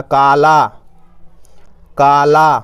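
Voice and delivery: "काला" with both long vowels drawn out; teacher sounding out "काला"; reading demonstration. Shown as a demonstration. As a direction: stretch each syllable of "काला" evenly. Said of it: "तो बस ऐसे पढ़ना".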